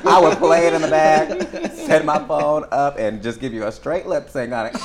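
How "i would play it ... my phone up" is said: laughing. Real, not acted. Laughing through a funny memory.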